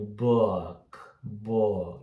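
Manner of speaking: slow, low stretched vowel in "book"; between U and O. Shown as a demonstration. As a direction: draw out "book" midway between oo and oh. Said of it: "ele é um meio termo do u com o". ("book" devagar, entre U e O)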